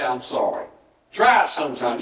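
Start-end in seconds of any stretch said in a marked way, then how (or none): 0.0-0.7 soft, lower "I'm sorry"; simple apology. Shown as a demonstration. Preacher showing the apology without the but, quiet and simple. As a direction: say it quietly and let it drop. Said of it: "leave the but out, say".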